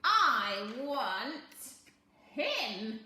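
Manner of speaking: a lightly voiced scheming little man, slow and loud; sly little man. A narrator's reading. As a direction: bargain like a crafty imp.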